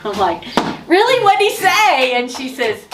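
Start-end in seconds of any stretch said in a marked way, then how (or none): 0.9-2.1 much higher and louder, eager; eager surprise. Retold or imitated. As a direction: jump in, dying to know. Friend says God spoke.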